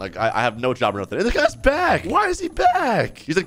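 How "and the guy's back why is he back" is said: very high, drawn-out mock alarm; alarmed disbelief. Player seeing the guy come back, mock alarm. Unwelcome repo man returns.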